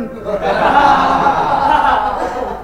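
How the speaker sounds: laugh. Acted. Laugh in overfed man's voice.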